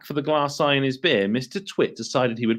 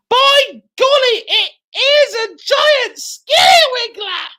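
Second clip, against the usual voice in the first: hammed-up alarm; 7 dB louder than usual. Mr Twit mock alarm.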